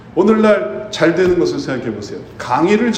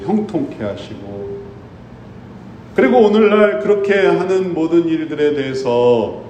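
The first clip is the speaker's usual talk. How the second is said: ordinary delivery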